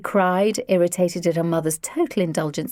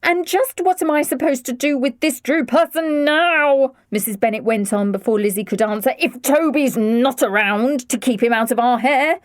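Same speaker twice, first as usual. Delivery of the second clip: indignant mother; 6 semitones higher, 4 dB louder than usual. A narrator's reading.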